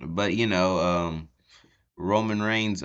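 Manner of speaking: ordinary delivery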